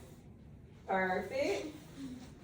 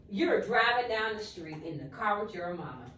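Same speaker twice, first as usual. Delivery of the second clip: usual speaking voice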